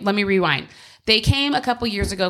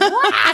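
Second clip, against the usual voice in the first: mock wail; 11 semitones higher, 5 dB louder than usual. Mimicking sudden crying.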